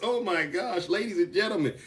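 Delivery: ordinary talk, nothing marked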